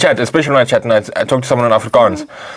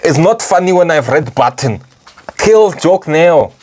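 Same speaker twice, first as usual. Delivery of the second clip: slow, higher mock Russian accent; Putin impression; 4 semitones higher, slower than usual. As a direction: draw out the order in hammed-up Russian English. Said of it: "Putin himself will come, like".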